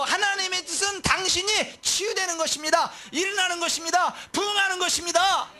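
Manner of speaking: high, ringing proclamation; high-pitched, insistent pulpit declaration; crying out. Acted. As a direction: declare healing like a pastor at full cry. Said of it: "이거를 외치는 게 목사라는 거예요".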